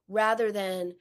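normal talking voice

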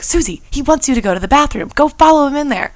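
insistent mimicked friend, pushing her to act; urging friend. Friend sends her after the man, retold insistent urging.

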